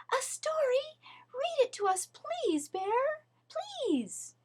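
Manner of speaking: high, begging little voices; eager kids pleading for a story; excited shouting. Acted. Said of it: "they all shouted".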